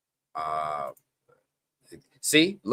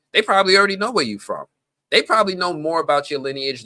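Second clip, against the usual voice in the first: higher, loud and fast; emphatic; 8 semitones higher, 4 dB louder, faster than usual. Real, not acted. Warning viewers about their data, high fast insistence. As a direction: rush it out, urgent and raised.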